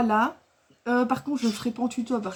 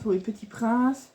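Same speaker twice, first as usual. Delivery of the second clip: usual speaking voice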